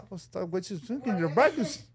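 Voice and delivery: faking the oath in a mumble; soft mumble. Retold or imitated. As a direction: mutter the gibberish quietly. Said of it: "hablo bajito".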